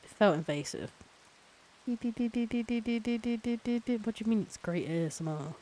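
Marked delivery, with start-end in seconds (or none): 1.9-4.1 high, monotone string of beeps; rapid high electronic-style beeps; imitated beeping. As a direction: beep like a little machine, evenly.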